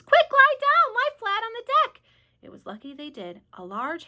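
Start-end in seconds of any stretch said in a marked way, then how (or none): 0.0-2.0 much higher, louder warning; urgent cry. A narrator's reading. James warning everyone of the hail, high and loud. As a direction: yell the warning to save them. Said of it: "cried James".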